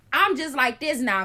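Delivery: usual speaking voice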